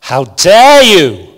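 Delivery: slow, loud and accusing, each word spaced; indignant outrage. Retold or imitated. Teenage activist rebuking world leaders, slow loud outrage. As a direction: fling the words out in furious disbelief. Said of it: "given rise to massive number of memes".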